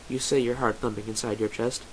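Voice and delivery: ordinary delivery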